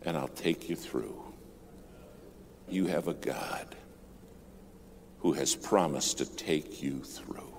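soft, low, sinking into a whisper; hushed. Real, not acted. Preacher to the congregation about God's promise, hushed and low. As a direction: draw the congregation in, near whisper.

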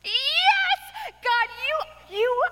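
high, sputtering protest at God; mock exasperated prayer. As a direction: stammer out a hammed-up complaint to God.

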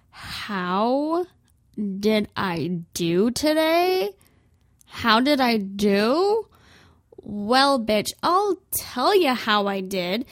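slow, drawn-out indignant retort; slow menacing sass. Retold or imitated. Customer turning on the clerk, slow and indignant. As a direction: build up a slow threat. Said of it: "I'd say, like".

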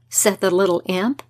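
ordinary delivery

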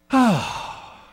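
long breathy "ah" sigh; put-on weary sigh. Husband sighs so wife will ask, put-on weary sigh. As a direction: exhale audibly, hoping to be asked. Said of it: "I would do the breathing technique".

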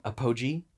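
"Apogee" as uh-POH-jee, rising questioningly; alternative pronunciation. Shown as a demonstration. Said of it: "I hope I'm saying this right".

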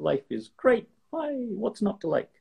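lightly voiced, relaxed grazer; antelope voice.

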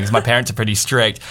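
ordinary talk, nothing marked

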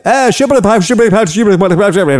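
high, sing-song fake Italian babble; hammed-up Italian-sounding nonsense; mock Italian gibberish. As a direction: imitate an old Italian guide rattling off words you can't follow. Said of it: "he says to us in Italian".

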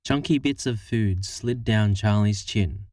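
plain reading voice

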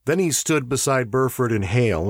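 usual speaking voice

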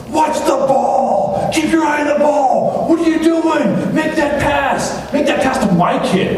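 high, loud mock sideline yelling; crazy sport parent yelling. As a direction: bark at the field like an overzealous parent. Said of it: "They're yelling like crazy".

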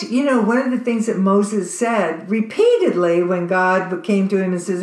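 ordinary delivery

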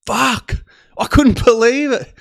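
high, loud laughing disbelief; incredulous. Retold or imitated. Traveller recalling the find, amazed. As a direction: exclaim it, pitched right up. Retells his shock at being found out.

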